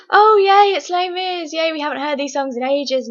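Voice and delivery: high, squealing mock delight; mock family excitement. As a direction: gush like a giddy fan hearing old songs. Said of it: "being like".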